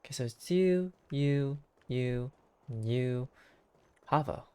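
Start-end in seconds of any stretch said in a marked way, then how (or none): none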